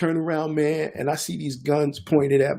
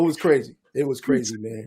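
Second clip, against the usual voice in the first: ordinary talk, nothing marked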